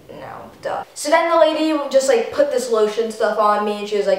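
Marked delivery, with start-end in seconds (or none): 0.1-0.8 "No, duh" whispered; whispered aside. Retold or imitated. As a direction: breathe it out dismissively. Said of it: "She's like".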